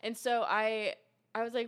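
ordinary talk, nothing marked

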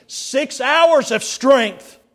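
preaching intensity; emphatic. Real, not acted. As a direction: lean hard on every word. Preacher stressing Christ's suffering.

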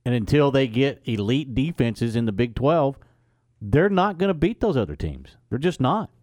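emphatic. Real, not acted. Emphatic sports debate, loud.

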